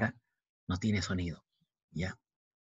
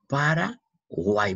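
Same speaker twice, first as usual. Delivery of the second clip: slow, higher "Paraguay", para, pause, guay; syllable by syllable; 4 semitones higher, much slower than usual. Shown as a demonstration. Teacher pointing out the Y, "Paraguay" slowly split. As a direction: stretch "Paraguay" and break it before guay.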